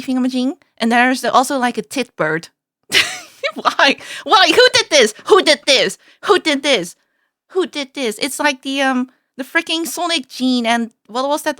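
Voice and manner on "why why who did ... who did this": loud demand, easing with each repeat; mock outrage. Real, not acted. Viewer protesting silly bird names, loud mock outrage. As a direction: demand the culprit loudly, in playful disbelief.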